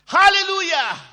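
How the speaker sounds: high, loud ecstatic cry of hallelujah; shouted hallelujah. Retold or imitated. Excited poor man interrupting the church, ecstatic shout. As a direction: shout it out at the top of your voice. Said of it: "he would shout".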